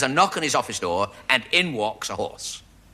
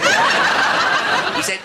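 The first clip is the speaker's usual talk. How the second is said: laughing; 8 dB louder than usual. Real, not acted. Laughing through narration.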